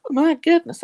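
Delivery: loud surprised exclamation; exclaiming. Real, not acted. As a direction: burst out with it. Exclaiming about exercise struggles.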